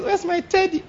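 put-on high, soft little-girl voice; high mock baby voice; childish whine. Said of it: "No, I use".

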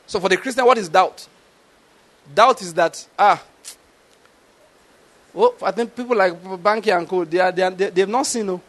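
ordinary talk

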